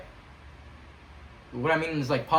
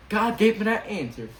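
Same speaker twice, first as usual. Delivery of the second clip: excited; 7 semitones higher, 4 dB louder than usual. Real, not acted. Joy at answered prayer.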